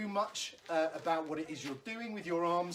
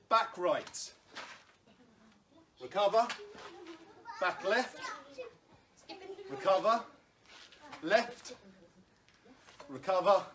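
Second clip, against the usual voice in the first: calling out drills; 3 semitones higher than usual. Acted. Coach calling out footwork drills.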